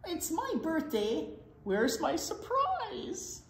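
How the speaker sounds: high-pitched, left-out protest; plaintive cry. A narrator's reading. Skunk wants fun on his birthday, plaintive high cry. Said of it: "cried Skunk".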